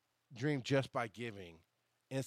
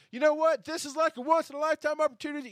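much higher and louder, hyped-up showman voice; excited pitch; 7 dB louder than usual. Retold or imitated. Imagining hyping the crowd, high excited pitch. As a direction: sell it like a big moment.

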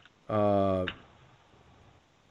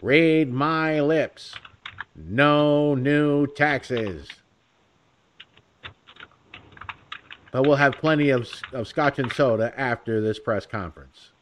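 George H W Bush impression; 3 semitones higher, 4 dB louder, slower than usual. Vlogger showing his cheesy Bush senior, slow and loud. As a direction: deliver it like a podium promise. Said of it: "I could sit here and go".

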